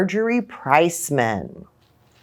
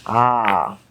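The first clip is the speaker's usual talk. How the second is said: plain reading voice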